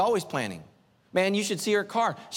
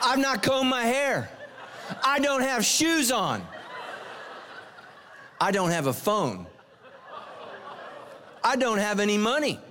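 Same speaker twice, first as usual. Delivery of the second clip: laughing; 7 semitones higher than usual. Real, not acted. Preacher laughing to the congregation about running out of gas. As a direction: laugh at your own bad luck as you tell it.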